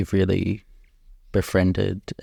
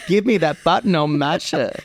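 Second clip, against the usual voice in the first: laughing; 8 semitones higher than usual. Real, not acted. Host laughs along.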